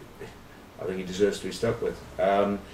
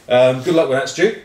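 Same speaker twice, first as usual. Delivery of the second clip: laughing; 6 semitones higher, 7 dB louder than usual. Real, not acted. Laughing at the clip.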